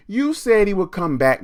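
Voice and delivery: put-on high, loud, snippy kid contradicting; loud whiny brat; defiant bratty girl. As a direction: talk back to the teacher like a sulky girl. Kathy blaming the teacher.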